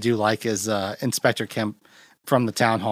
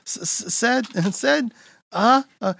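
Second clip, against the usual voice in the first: much higher, strained stammering; 11 semitones higher than usual. Retold or imitated. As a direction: squeeze out the word while choking. Imitating choked charades attempt.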